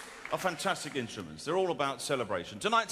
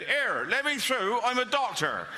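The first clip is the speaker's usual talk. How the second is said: put-on West Country accent; 8 semitones higher than usual. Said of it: "you said".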